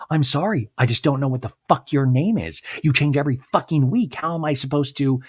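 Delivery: hammed-up defensive mom in a fight; mock exasperated mom. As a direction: throw it back at her daughter. Mom snapping back in quarrel.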